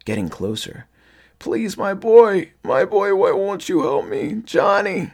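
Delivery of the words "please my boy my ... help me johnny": loud, high, drawn-out begging; pleading. A narrator's reading. Father-like thing begs Johnny for help, pleading. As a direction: plead in a high creature voice, stretching the words. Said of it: "he pleaded".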